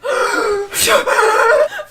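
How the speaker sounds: high, loud frantic gasp; mock drowning gasp with a laugh; panicked gasp. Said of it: "then she's like".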